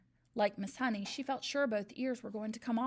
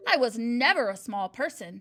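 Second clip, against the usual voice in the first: a loud, cutting snap of denial; snapped; 10 dB louder than usual. A narrator's reading. Trunchbull snaps at the boy who questions her, loud and cutting. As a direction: bite the words off hard. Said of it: "she snapped".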